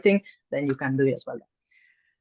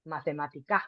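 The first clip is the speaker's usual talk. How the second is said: mathematica with the force on the final syllable; stress accent; 2 semitones lower, 8 dB quieter than usual. Shown as a demonstration. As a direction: lean on the last "ca" of "mathematica". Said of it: "with more force".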